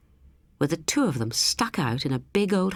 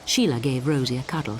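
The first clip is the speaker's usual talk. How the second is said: ordinary delivery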